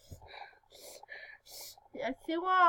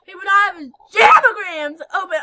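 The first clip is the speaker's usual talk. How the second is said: much louder frustrated complaint; yelled; 3 semitones higher, 12 dB louder than usual. Real, not acted. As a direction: blurt it out loud at the game. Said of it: "Oh, I yelled".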